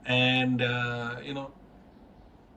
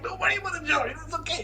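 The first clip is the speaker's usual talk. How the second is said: much higher squeaky mock voice brushing it off; mock breezy boss; faster than usual. Boss dodging the job question, mocking falsetto. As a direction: imitate the boss waving the question away in falsetto. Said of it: "he's like".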